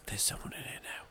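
imitated horror-movie whisper. Quoting Mia's bedroom scene, whispered. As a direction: whisper it barely audibly.

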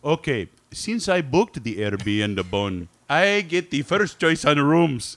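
slow comic character voice; put-on bandmate voice. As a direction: draw it out as a mock-serious character. Acts out bandmate in accent.

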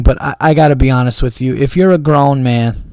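usual speaking voice

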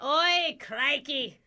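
broad, badly faked Aussie; put-on Australian accent. Prankster caught out by interviewer, sudden fake Australian accent. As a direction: switch to hammy Australian. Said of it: "did you just change your accent?".

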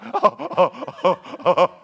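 eager dog panting, laughing through it; preacher voicing a thrilled dog, half laughing; excited dog. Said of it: "that dog is like".

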